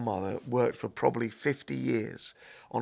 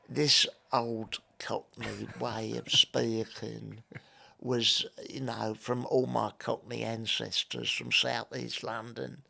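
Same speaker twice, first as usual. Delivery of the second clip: put-on old Cockney; slower than usual. Shown as a demonstration. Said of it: "this old Cockney sound that I grew up with, I could sort of recreate".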